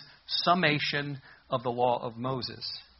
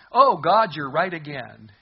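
game show host; 11 dB louder, slower than usual. Retold or imitated. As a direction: boom it out like a TV quiz host. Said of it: "the guy leading the game goes".

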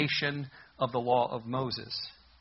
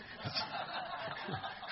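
soft airy chuckle to himself; breathy laugh; 10 dB quieter than usual. Real, not acted. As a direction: laugh quietly at the joke. Laughing at his own cartoon joke.

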